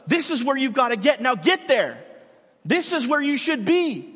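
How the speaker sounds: loud, high push forward; demanding coach. Retold or imitated. Coach pushing a player forward, loud and demanding. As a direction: urge him to reach the goal. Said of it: "But it's also".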